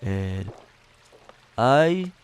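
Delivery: lightly voiced, slow, drawn-out blustery politician; a half-hearted mock of a bragging tycoon, slowed down; Donald Trump voice. Making fun of the voice everybody does, half-hearted slow impersonation. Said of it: "the same, like, Donald Trump voice".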